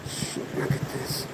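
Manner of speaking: whispered, amazed; hushed surprise. Real, not acted. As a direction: breathe it out in awe.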